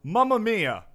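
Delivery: put-on Italian accent, slow and loud; jolly italian pizza chef.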